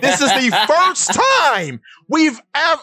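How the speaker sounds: excited voice cracking with a laugh. Real, not acted. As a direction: voice cracks up high mid-phrase. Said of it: "oh, my voice cracked".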